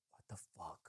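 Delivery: barely audible, low whisper; retold shock, breathed to yourself; very soft aside; under the breath. Retells muttered shock at stranger's closeness.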